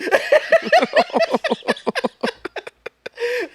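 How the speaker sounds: drawn-out wheezing laughter; can't stop laughing; real laughter with friends; long high laugh. Real, not acted.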